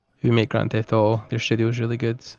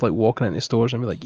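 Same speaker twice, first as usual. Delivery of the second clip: ordinary delivery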